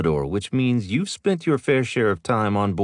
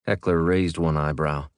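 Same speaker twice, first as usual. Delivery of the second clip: usual speaking voice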